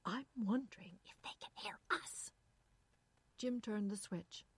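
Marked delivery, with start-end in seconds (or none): 0.1-2.3 partly whispered, uneasy wondering; hushed worry. A narrator's reading. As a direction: drop into a nervous whisper. Irene fears being overheard.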